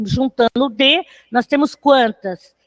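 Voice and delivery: ordinary delivery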